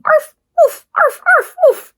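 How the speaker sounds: playful puppy yaps; barking dogs. Acted. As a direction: bark like little dogs.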